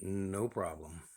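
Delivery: usual speaking voice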